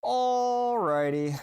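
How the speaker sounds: high, very slow sing-song alrighty; drawn-out sing-song. Acted. Host stretching his sign-off word to the chat, drawn-out and high.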